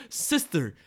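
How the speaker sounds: mock Eastern European accent, slow and loud; Quicksilver. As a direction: play an indignant brother with a thick joke accent. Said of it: "he has an accent and Wanda doesn't".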